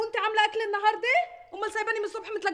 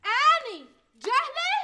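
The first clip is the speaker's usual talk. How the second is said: slow offended echo; 5 semitones higher, much slower than usual. Acted. Maid throwing the insult back at the wife, offended. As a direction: drag out اني جهله as slow, stung disbelief. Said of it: "repeats the insult".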